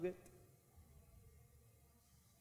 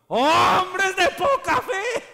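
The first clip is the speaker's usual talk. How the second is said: slow, high, loud rebuke; booming rebuke; 11 semitones higher, 10 dB louder, much slower than usual. Acted. Preacher booming God's rebuke to the congregation, slow and loud. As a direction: thunder it out slowly like a divine reproach.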